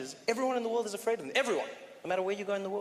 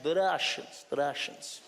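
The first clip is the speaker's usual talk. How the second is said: put-on Russian accent; 5 semitones lower, slower than usual.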